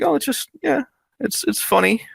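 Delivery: high, breezy, dismissive amusement; airy mock of the whole thing; amused shrug. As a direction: toss it off as a silly joke. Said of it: "we were mostly just amused".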